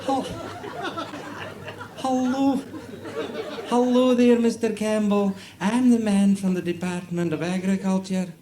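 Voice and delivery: high-pitched, hesitant official; comic high voice, stumbling over the greeting; Department of Agriculture official. Acted. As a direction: pipe up as a visiting government man. Plays the visiting Department official.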